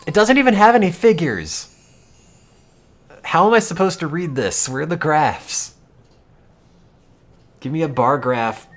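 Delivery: high, loud exasperated complaining; mock outrage. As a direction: whine about the missing graphs like it's a scandal. Mock outrage at a figureless article.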